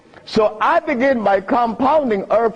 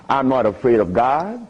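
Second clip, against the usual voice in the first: usual speaking voice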